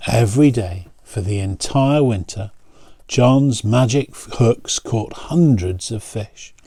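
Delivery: ordinary delivery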